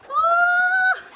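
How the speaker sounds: high, loud squeal of delight; excited scream. Real, not acted. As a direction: let out a piercing happy shriek. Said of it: "I'm so excited".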